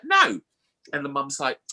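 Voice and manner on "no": loud, high mock refusal; hammed-up high, loud doctor; exasperated doctor. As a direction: shut the request down emphatically. Doctor's refusal acted again.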